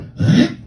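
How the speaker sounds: sudden loud gasp; sharp startled intake of breath. Retold or imitated. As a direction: gasp in mock shock. Reenacts the Spanx ripping.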